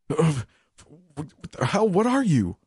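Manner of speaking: stabbed prisoner shrieking at her; pained terrified cry. A narrator's reading. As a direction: yelp in pain and terror. Stabbed man crying out.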